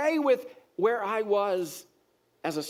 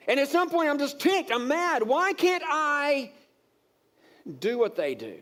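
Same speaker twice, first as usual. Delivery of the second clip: worked-up frustration; 7 semitones higher than usual. Retold or imitated. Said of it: "I'm getting ticked".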